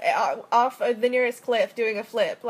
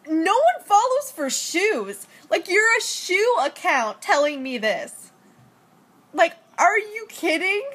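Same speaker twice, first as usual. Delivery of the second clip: higher, louder and slowed, incredulous at the trolls; indignant clapback; 7 semitones higher, 3 dB louder than usual. Retold or imitated. Imitating her own reply to the shoe account trolls, indignant and slow. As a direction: spell out the absurdity, each word dragged. Clapping back at rude trolls.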